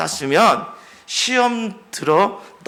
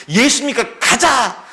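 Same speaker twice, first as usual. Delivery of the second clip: drawn-out rallying cry, higher; slow rousing call; 7 semitones higher, 4 dB louder, slower than usual. Acted. Preacher rallying the church to go with Jesus, slow fervent call. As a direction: call the congregation to follow Jesus.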